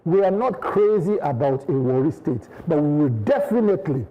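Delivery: ordinary talk, nothing marked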